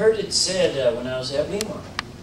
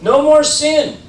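"No more sin." very high and loud, falling hard on "more"; a loud, high-pitched command with "more" driven home; emphatic; 12 semitones higher, 9 dB louder than usual. Shown as a demonstration. Jesus ordering the woman away from sin, emphatic.

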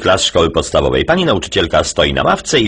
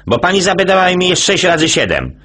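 put-on high, eager boy voice; kid voice; 12 semitones higher than usual. Jasio explaining to dad, high kid voice. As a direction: talk up high like a schoolboy.

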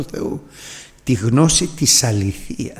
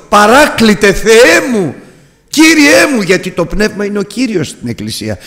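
louder, higher impassioned preaching; fervent preaching; 9 semitones higher, 5 dB louder than usual. Acted. Preacher pleading with the Holy Spirit before the church, louder and higher. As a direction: pour out the longing, rising to a shout.

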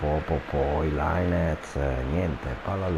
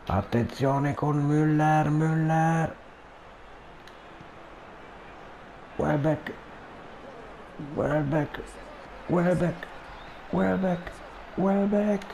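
rising Italian commentator frenzy, building to a shout; breathless Italian goal commentary; 12 semitones higher, slower than usual. Acted. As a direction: build from tense to screaming. Gol imminente, nomi ripetuti in crescendo. (telecronaca italiana concitata da gol)

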